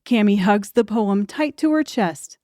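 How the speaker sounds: normal talking voice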